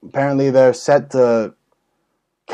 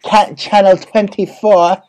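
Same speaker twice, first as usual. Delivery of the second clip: slow, put-on alien reporter voice; alien reporter impression; 2 semitones higher, slower than usual. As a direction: drawl it out as a smug hidden alien. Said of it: "He's like".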